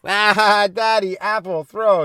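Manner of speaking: put-on high, loud babbling little kid; imitating a small child; toddler voice. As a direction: play the gleeful toddler tossing food.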